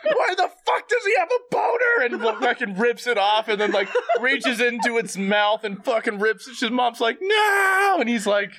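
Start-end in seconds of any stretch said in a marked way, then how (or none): 0.0-2.0 hammed-up shock; overacted scream of disgust; mock shout. Andrew shouting at doll. 7.2-8.0 high, loud no; hammed-up cry of protest; overacted horrified mother; mock scream. Mom screams.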